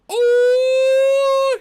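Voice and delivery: sudden high shout of oh; loud high laugh. Retold or imitated. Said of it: "he laughs".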